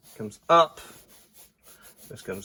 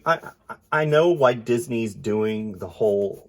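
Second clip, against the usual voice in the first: normal talking voice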